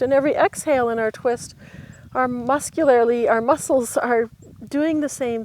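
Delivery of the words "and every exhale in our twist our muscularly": ordinary talk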